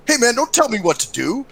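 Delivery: mildly heated retort, pitched high; high, mock-indignant protest. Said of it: "it got a little heated".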